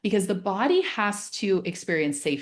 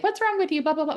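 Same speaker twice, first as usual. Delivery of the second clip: put-on much higher, scolding a scared kid; mock shaming; 10 semitones higher, faster than usual. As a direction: brush the child off impatiently. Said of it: "shame them or be like".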